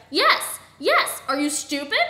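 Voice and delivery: punchy, exasperated outburst; exasperated yelling. A narrator's reading. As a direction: shout back impatiently. Exasperated retort to startled question.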